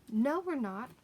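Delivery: hasty denial. Acted. Girl hastily denying to the animals she is lettuce, quick protest. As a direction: rush to deny it before they eat you. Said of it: "said Polly hastily".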